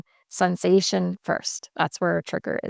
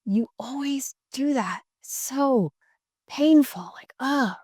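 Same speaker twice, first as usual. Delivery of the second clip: higher, accusing whine of blame; contemptuous complaint; 6 semitones higher than usual. Shown as a demonstration. Upset partner blaming the other, a higher contemptuous whine. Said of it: "there's a big difference between".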